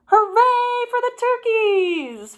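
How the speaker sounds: high, loud cheer. A narrator's reading. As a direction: cheer them on with joy. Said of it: "Farmer Kate and Farmer Joe cried".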